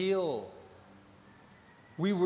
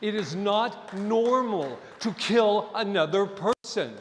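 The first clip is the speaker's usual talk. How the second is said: forceful; 4 semitones higher, 6 dB louder than usual. Real, not acted. Preacher building to forceful declaration.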